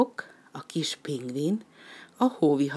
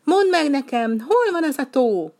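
much higher, louder call; 10 semitones higher, 6 dB louder than usual. Acted. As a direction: call up into the sky. Penguin calls after duck.

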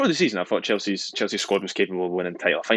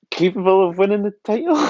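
stifling a laugh; 13 semitones higher, 6 dB louder than usual. Real, not acted. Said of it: "I can't say it without laughing".

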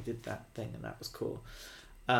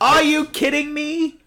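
outraged yell; 12 semitones higher, 9 dB louder than usual. Real, not acted. Outraged at the repeated plots.

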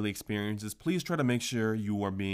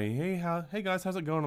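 higher, upbeat imitation of a waiter; cheery waiter greeting; 8 semitones higher than usual. Lightly imitated friendly waiter. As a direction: waiter greeting the guys at the game, a bright imitated hello.

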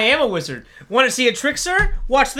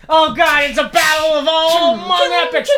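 excited yelling over battle; loud, higher, panicked commentary; frantic shouting; 6 semitones higher, 5 dB louder than usual. Acted. Announcer watching battle.

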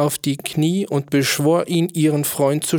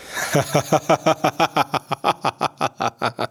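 king's guffaw; 3 semitones higher than usual. A narrator's reading. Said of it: "Krölte letzterer".

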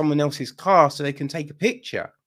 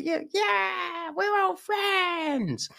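put-on very high, stretched-out little-kid cheer; put-on squeaky kiddie voice; six-year-olds chorusing; 4 dB quieter, much slower than usual. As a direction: squeal it like a gleeful small child. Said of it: "little fucking six-year-olds all sitting".

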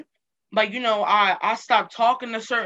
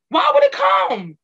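much higher, louder, exclaimed; emphatic repeat; 14 semitones higher, 5 dB louder than usual. Shown as a demonstration. To her listener, repeating the question in a high, louder exclaim. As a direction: fling the question up high in disbelief. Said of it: "why would it come to you?".

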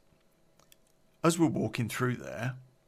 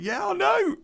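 imitating him in a much higher voice; mock reply from him; 8 dB louder than usual. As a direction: toss it back in a squeaky, higher mock voice. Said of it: "And he was like".

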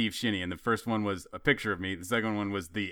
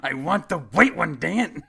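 mock entitled demand; 8 semitones higher, 5 dB louder, slower than usual. Mocking a fussy customer.

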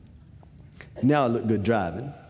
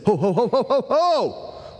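much higher, louder ho ho to halt him; calling out to stop; 11 semitones higher, 4 dB louder, slower than usual. Retold or imitated. Dad halting his son taking the bed apart, loud and high. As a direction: cut him off with a loud whoa call.

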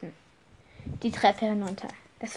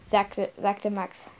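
plain reading voice

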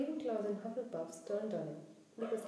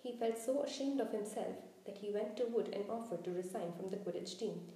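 usual speaking voice

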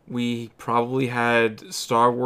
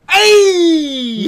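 triumphant cheer; excited shout; 11 dB louder than usual. Real, not acted. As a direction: yell it out in glee. Triumphant shout at being right.

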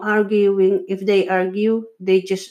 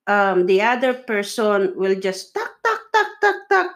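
nagging chatter. Retold or imitated. Mimicking a nagging partner.

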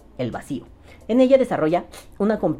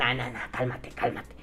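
much softer, lower hushing; mock calming down; 4 semitones lower, 9 dB quieter than usual. Talking down an overexcited vaping defender, softly calming. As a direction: talk the fanatic down quietly. Calms the zealot he just voiced.

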